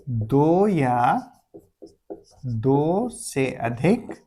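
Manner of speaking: slow, soft, word-by-word dictation; pausing dictation pace; dictation reading. Shown as a demonstration. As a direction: read the rule out slowly for notes. Reads the rule slowly.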